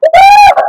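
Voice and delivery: high whooping cheer. Real, not acted. Coach cheers the ladies through the plank with a high whoop. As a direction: whoop to pump up the class.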